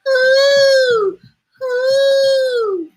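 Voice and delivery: high hooting owl call; a high owl call for the child; owl hoots. Acted. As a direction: hoo like an owl, high and long. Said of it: "owls that call hoo".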